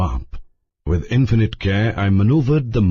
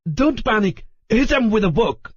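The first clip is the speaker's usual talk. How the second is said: high, urgent shouted orders; roaring Leslie; 11 semitones higher than usual. A narrator's reading. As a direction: bark orders over the chaos. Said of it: "roared Leslie".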